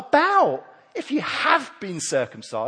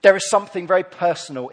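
usual speaking voice

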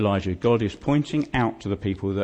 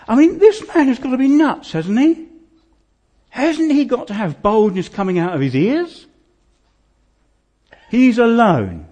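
fervent emphasis; 12 semitones higher, 9 dB louder than usual. Real, not acted. Preacher's rising emphatic climax.

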